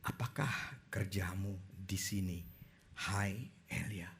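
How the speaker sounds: soft, slow whispered question; gentle whisper. A narrator's reading. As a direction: breathe it out like a still small voice. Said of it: "suara embusan yang lembut".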